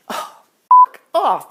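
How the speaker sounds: loud, high, slow groan of dismay; exasperated outburst. Retold or imitated. Comic dreading the karaoke call, loud dismay. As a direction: bellow it in mock horror.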